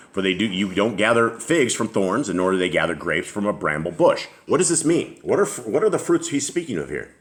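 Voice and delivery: ordinary talk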